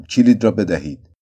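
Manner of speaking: harsh demand. Acted. Doctor snatching the key from the nurse, harsh. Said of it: "با خشونت گفتم".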